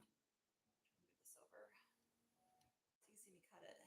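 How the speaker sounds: soft unvoiced whisper. Real, not acted. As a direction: breathe the words quietly. Whispering while cutting.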